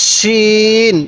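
শিন with a thick sh and long-held vowel; thick sheen. Shown as a demonstration. Teacher contrasts two confused letters, thick sh in শিন. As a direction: make the sh of শিন full, then stretch it evenly. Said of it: "একটু মোটা করে উচ্চারণ".

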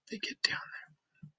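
hushed storyteller whisper; whispered narration. A narrator's reading. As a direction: whisper as they creep down. Narration sneaking underground.

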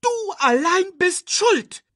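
very high, loud accusing screech. A narrator's reading. Timon blames Nala alone, screeching. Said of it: "kreischte Timon Nala an".

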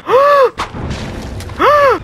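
real jolt of fright; sudden scream at a jump scare; startled scream. Real, not acted. Jump scare in horror game.

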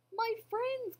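high, anxious elephant cry; urgent cry. A narrator's reading. Elephant calling to his lost friends, urgent cry. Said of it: "cried the elephant".

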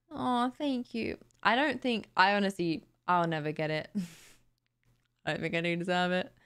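ordinary talk, nothing marked

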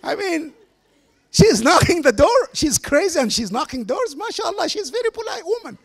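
high-pitched comic protest; mock-earnest insistence. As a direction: push the punchline up high, as if defending her in earnest. Acting out his joking reply, punchline.

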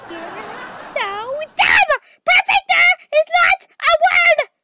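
drawn-out build into a high shout; exploding in anger. Acted. Correcting her friend's word "perfecter", shouting angrily. Quarrel over a silly word.

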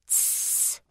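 very soft breathy cat hiss. A narrator's reading. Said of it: "hissed Cobweb".

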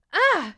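high, loud cry of dismay; displeased "ah". A narrator's reading. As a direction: exclaim in annoyed surprise. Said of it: "and frowned".

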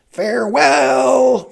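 very high, very slow, loud; mocking farewell. Sicilian bidding Buttercup farewell, drawn out high. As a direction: stretch the farewell cruelly.

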